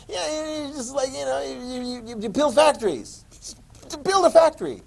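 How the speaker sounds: high, breezy mock-easy tone; mock naive simplicity. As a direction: pretend it's dead simple. Teacher mocks naive answer.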